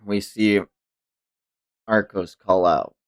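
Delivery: ordinary talk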